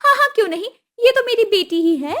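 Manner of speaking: demanding mother-in-law. Acted. Kusum demanding the dowry at the wedding, high and pushy. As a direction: ask for the money like it's owed.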